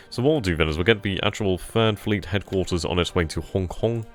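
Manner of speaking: usual speaking voice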